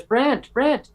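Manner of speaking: high-pitched call of a name; imitated calling out. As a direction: call out to get someone's attention, high. Manager calls out his name.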